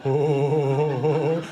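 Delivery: put-on low, dopey teenage-boy grunts; hammed-up low teen guffaw. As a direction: imitate a dim teen's deep laughing "huh". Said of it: "every teenage boy sounds like that".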